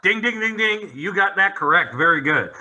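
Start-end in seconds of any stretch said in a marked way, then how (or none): 0.0-0.9 game show bell. Acted. Game show correct-answer bell.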